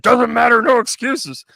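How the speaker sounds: high, loud boss's rebuke; mock angry boss. Acts out boss cutting off employee.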